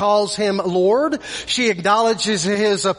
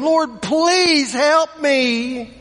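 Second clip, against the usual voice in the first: pleading; 3 semitones higher, much slower than usual. Acted. Desperate mother pleading before Jesus, fading plea. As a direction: beg with everything left. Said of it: "pleading before him".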